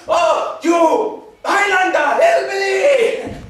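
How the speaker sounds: slow, drawn-out top-volume insult; high-pitched, explosive outrage; furious shout. Acted. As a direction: scream the insult, stretching it out. Climax of insults.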